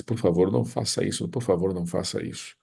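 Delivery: usual speaking voice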